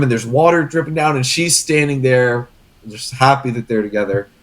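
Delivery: usual speaking voice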